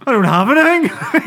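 high, loud put-on protest; hammed-up high denial; mock innocent denial. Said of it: "He's all".

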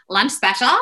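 "special" in a put-on American accent. Shown as a demonstration. Speaker comparing how she orders, "lunch special" in mock American. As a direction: say "lunch special" like a Valley Girl. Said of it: "they don't sound that different".

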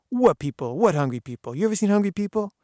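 loud, high, incredulous scoff; mock the whole idea; scoffing challenge. Gardener scoffing at the hunger benefit, loud and incredulous.

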